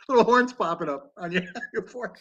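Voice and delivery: high laughing tease. Real, not acted. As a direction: giggle through the words. Teasing friend's red face.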